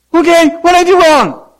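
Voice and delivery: put-on high, loud innocent kid; mock child voice. Caught daughter answering her parent, high mock innocent. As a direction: play the caught child protesting.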